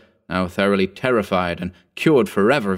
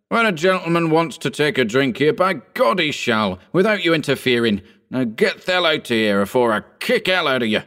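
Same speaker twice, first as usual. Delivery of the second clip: raised, angry threat; furious; 6 semitones higher than usual. A narrator's reading. Sheehan furiously ordering old Bugs out, raised pitch. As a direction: throw him out. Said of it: "he exclaimed furiously".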